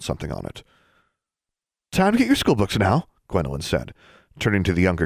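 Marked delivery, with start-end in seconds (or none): 1.9-3.1 put-on high, bright calling to children; cheery teacher call. A narrator's reading. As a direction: round up the kids, sing-song.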